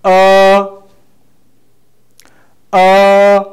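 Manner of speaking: long, high, level "Uh". Shown as a demonstration. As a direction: stretch "Uh" loudly on one steady pitch. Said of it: "guides us to pronounce it in long".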